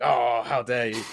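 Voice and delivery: slow, put-on outraged "how dare you"; mock indignation. Party guest scolding someone who didn't give way, mock outrage. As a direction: play the offended party-goer, slowed and huffy. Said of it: "They're like".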